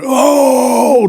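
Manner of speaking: high loud mock crowd groan; imitating the crowd at a strikeout. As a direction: mimic the stadium reaction.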